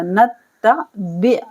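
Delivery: slow recited word; Quran recitation. Shown as a demonstration. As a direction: chant the word slowly. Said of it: "teacher recites word slowly".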